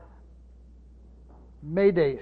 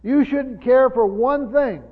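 slow, louder, higher, stressing "You"; emphatic; 6 semitones higher, 8 dB louder, slower than usual. Shown as a demonstration. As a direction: press the point home word by word. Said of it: "so it's emphatic".

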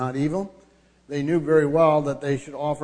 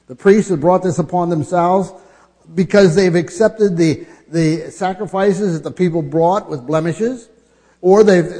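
louder, higher preaching rebuke; 3 semitones higher, 7 dB louder than usual. Acted. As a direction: drive home whose fault it is. Preacher builds the charge.

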